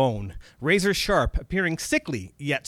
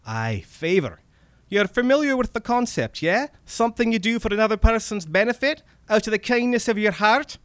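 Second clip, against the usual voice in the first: high, loud, mocking Scottish accent; sarcastic Scottish; 8 semitones higher, 5 dB louder than usual. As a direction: explain sarcastically as a little Scottish vampire. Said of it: "A sarcastic Scottish vampire midget".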